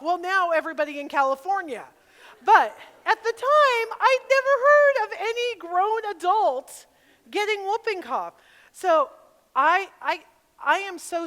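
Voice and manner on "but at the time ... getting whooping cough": loud, high, emphatic comic disbelief; incredulous. Real, not acted. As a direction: belt it out, amazed at the absurdity. Comic outrage at rare illness.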